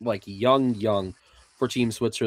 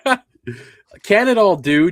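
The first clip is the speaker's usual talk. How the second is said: higher and louder through a laugh; 9 semitones higher, 8 dB louder than usual. Real, not acted. Host giggles, agreeing to do Canada, laughing. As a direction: laugh as you give in. Laughs before attempting an impression.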